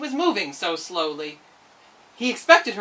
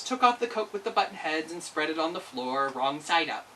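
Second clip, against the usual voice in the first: ordinary delivery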